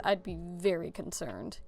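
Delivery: usual speaking voice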